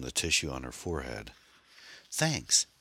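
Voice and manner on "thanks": high, small thanks; young woman voice. A narrator's reading.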